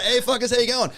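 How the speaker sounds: high, brash hello with a laugh in it; laughing party-boy greeting, pitched well up; Stifler greeting. Retold or imitated. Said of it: "I walk in like Stifler".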